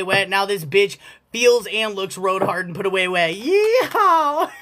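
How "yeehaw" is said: playful cowboy whoop, pitched up; cowboy holler. Pretend.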